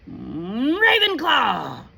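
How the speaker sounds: drawn-out high, loud announcement; hum, then cry out the house slowly; shouting hat. Acted. The Sorting Hat announces Hannah's house, shouting slowly.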